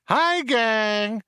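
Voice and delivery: high, over-eager computer greeting; enthusing computer. A narrator's reading. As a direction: greet everyone with gushing enthusiasm. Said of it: "enthused the computer".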